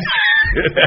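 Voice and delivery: a quick real burst of laughter; chuckling at his own remark; short laugh. Real, not acted. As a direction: laugh at the irony. Speaker laughs at his point.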